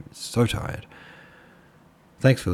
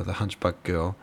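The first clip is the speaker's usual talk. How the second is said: ordinary delivery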